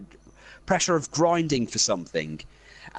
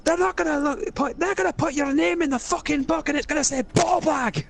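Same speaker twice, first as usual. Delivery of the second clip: higher Scottish voice, mocking and scolding; Scottish rant; 10 semitones higher than usual. Scottish character mocking the ring bearer's selfishness, higher voice. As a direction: berate the selfish hobbit in a Scot's voice. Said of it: "first time I've used the accent".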